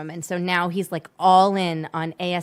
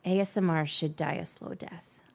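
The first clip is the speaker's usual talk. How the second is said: breathy mock ASMR whisper; 4 semitones lower, 7 dB quieter than usual. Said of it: "mock ASMR whisper after ASMR talk".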